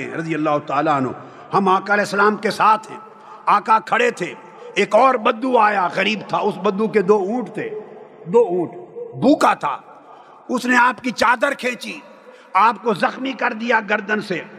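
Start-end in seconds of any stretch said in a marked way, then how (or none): none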